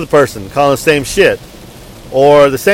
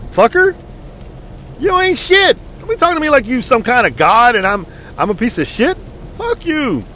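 much higher, spat-out cursing; angry insult; 8 semitones higher than usual. Retold or imitated. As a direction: tell him off with contempt. Cursing out rude agent.

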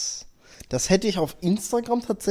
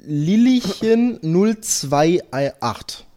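announcer shoutout; 3 semitones higher, much slower than usual. Acted.

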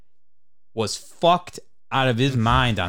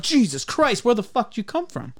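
much higher, startled exclamation; mock startled yelp; 11 semitones higher than usual. Startled waitress appears over shoulder, high yelp. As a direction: jump like she snuck up on you.

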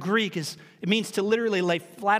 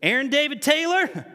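raised, higher-pitched mom in earnest warning; higher motherly call that means trouble; mama's full-name summons; 5 semitones higher, 3 dB louder than usual. Acted. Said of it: "here's what was worse".

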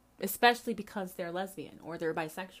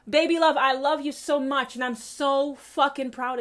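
proud cheer; 5 semitones higher, 8 dB louder than usual. Retold or imitated. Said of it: "comment, comment, comment".

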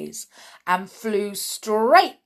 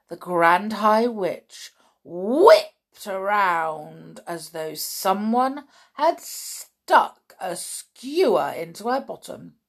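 plain reading voice

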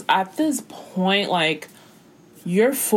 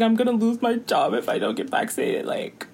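mock crying. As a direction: imitate a nurse crying over her job. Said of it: "She was literally crying".

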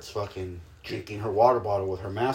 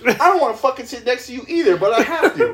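high, loud indignant rant; mock desk-mate quarrel; 13 semitones higher, 7 dB louder than usual. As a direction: squabble like a fed-up kid, laughing.

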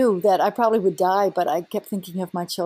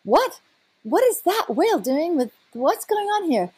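higher, amazed wondering; stunned and puzzled, pitched up; astonished inner thought; 6 semitones higher than usual. Retold or imitated. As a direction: replay the baffled shock of seeing it. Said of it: "And I'm thinking".

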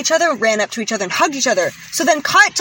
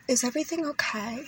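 soft concerned; 13 dB quieter than usual. Retold or imitated. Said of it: "she was like".

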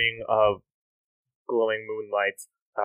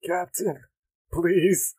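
higher, feeble begging voice; dying pirate plea; 7 semitones higher, much slower than usual. Acted. Dying pirates begging their captain, weak plea. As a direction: plead weakly with the captain. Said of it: "generic pirates who are on death's door, like".

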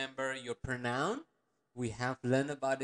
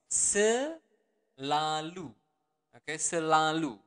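very slow; 4 dB louder, slower than usual. Shown as a demonstration. Teacher to learners, drawn-out Selalu. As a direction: stretch every syllable of Selalu far out, slow. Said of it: "the normal version would be selalu".

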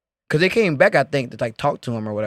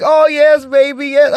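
high, louder gushing mock dancer; dancer imitation; 9 semitones higher, 6 dB louder, slower than usual. Dancer working on a customer, high gushing imitation. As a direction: coo it loudly, pitched way up. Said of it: "And she's like".

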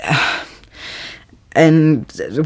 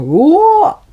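incredulous what; 13 semitones higher, 4 dB louder than usual. Real, not acted. Disbelief at time travel scene.

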